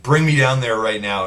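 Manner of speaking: retold irritated order; pissed-off order.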